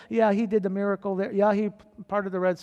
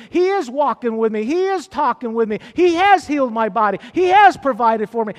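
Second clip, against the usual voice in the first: full-voiced preaching, louder; 5 semitones higher, 9 dB louder than usual. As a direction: preach it out to the church with conviction. Preaching to the church.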